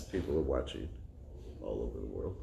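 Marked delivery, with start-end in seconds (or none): none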